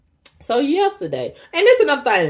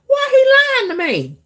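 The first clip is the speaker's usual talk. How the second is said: high, loud outraged question; indignant disbelief; 5 dB louder than usual. Real, not acted. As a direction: shriek it like you can't believe it. Indignant about Charles's lie.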